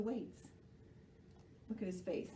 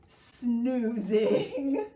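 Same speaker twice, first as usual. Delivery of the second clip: laughing; 4 semitones higher, 7 dB louder than usual. Acted. Animals laughing about Sloth.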